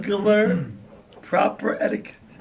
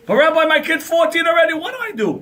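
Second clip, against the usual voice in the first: higher, louder anxious parent; parent's plea; 10 semitones higher, 4 dB louder than usual. Retold or imitated. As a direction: plead like a worried mom. Worried parent voiced.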